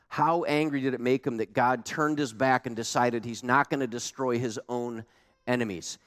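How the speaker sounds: ordinary talk, nothing marked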